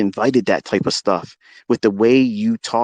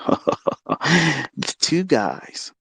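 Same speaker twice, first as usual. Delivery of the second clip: laughing; 4 semitones higher, much slower than usual. Real, not acted. Laughs while repeating it.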